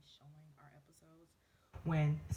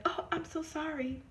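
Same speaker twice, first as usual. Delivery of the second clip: high, fake-sorry voice; mock apology; 11 semitones higher than usual. Imitating the manager's sudden apology, high and fake. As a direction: mimic her sudden apology. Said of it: "then she's like".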